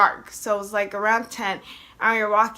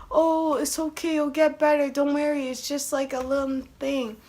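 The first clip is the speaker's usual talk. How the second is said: higher, placating comforting voice; mock reassurance; 4 semitones higher than usual. Well-meaning people comforting a sick person, mock reassuring. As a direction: brush it off sweetly, pitched up.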